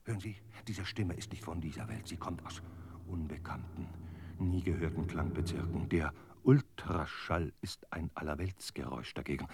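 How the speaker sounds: hushed, low, uncanny; eerie hush. Acted. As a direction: murmur it like something from another world. Voice not of this world.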